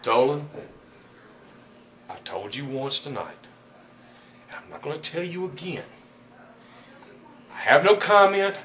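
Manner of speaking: angry. Real, not acted. Wrestler refusing interviewer's questions.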